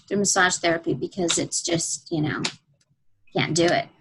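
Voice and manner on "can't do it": ordinary talk, nothing marked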